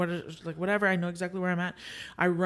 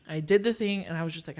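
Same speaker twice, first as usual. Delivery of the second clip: usual speaking voice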